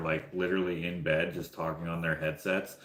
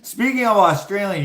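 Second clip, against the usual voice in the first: louder segue; 11 semitones higher, 10 dB louder than usual. Real, not acted. Follows remark on her Australian accent.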